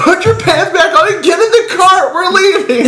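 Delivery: high laughing order to the cart; hammed-up dad yelling; mock dad shout. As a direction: bark it like a fed-up father.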